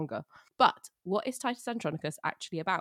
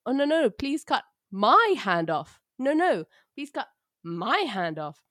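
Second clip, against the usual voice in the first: higher, louder, slow hammed-up pleading; mock pleading bicker; 6 semitones higher, 8 dB louder, slower than usual. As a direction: overact begging to lose a hand. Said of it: "essentially going".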